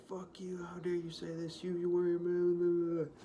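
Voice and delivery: high-pitched mimicry of outrage, building in volume; mock angry ranting. People pissed off on social media, mock angry rant. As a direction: imitate an online rant that dissolves into babble. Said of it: "it's just people pissed off, angry".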